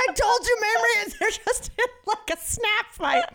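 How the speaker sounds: high, wailing tipsy explanation; whiny drunk friend. Retold or imitated. Drunk friend defending herself to the teller, high whiny voice. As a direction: whine it like a drunk pleading.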